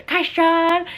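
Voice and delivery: imitated toddler's "Kaishan", lifted in pitch; toddler pronunciation. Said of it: "he doesn't say them exactly like that".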